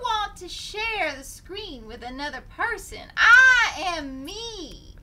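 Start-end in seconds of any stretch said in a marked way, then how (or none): 3.1-4.9 loud, slow, grand proclamation; dramatic declaration. Acted. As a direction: declare it like a diva.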